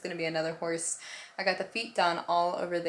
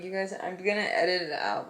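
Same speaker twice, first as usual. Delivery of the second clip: yawning. Real, not acted. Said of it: "I keep yawning".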